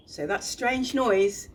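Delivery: normal talking voice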